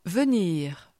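ordinary talk, nothing marked

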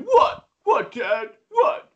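high, loud indignant squeal of what; a defensive teen yelping back; triggered brother. Retold or imitated. Brother snapping back at dad over the earring, high and indignant. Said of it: "And he's like".

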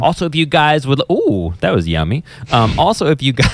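fighting off the giggles; trying not to laugh. Real, not acted. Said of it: "I'm trying to be professional".

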